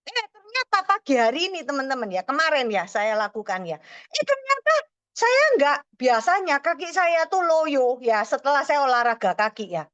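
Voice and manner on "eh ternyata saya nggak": surprised. Real, not acted. Discovers legs not tired.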